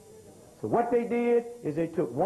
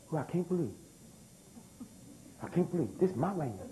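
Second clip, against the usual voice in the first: softer, disbelieving whine; mock complaining; 7 dB quieter than usual. Preacher mimicking New Yorkers complaining as water returns, softer mock whine. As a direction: mimic a stunned landowner muttering in protest. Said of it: "they're gonna be complaining".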